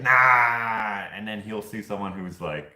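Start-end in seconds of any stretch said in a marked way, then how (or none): 0.0-1.1 loud, drawn-out imitation of a friend's no; imitated dismissal. Dakota rejects a vague lookalike, imitated loud dismissal. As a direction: dismiss it loudly, stretching the vowel.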